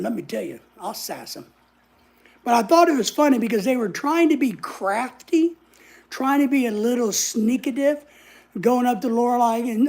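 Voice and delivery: ordinary talk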